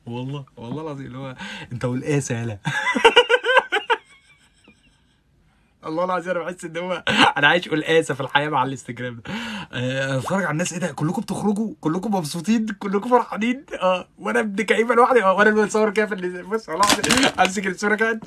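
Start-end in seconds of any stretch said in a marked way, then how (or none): 2.6-4.0 high, loud cackle of laughter. Real, not acted. Laughing loudly at her own taro joke. 5.9-18.2 higher and louder, worked-up complaint; excited rant. Real, not acted. Ranting to viewers about Instagram, higher and louder.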